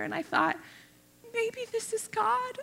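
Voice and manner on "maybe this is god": much higher, slow, wondering; dawning wonder. Retold or imitated. Realizing God speaks through the lady, high slow wonder. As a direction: let the thought dawn on you.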